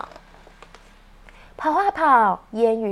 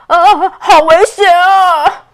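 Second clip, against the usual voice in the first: frightened; 6 semitones higher, 13 dB louder, slower than usual. Acted. Ouqi hides from thunder.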